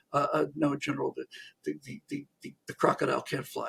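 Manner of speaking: low, soft, stammering hesitation; timid correction. Acted. Major nervously corrects his Red Army general, soft stammer. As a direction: falter while contradicting a superior.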